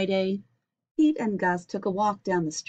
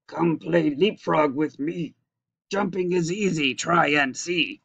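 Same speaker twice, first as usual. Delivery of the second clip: Grumpy Toad voice; 3 semitones lower than usual. Acted.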